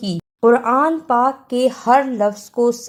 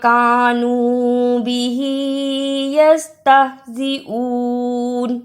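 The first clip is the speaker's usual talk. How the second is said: Quran recitation; 2 semitones higher than usual. Shown as a demonstration. Teacher reciting Quran verse to students, melodic chant.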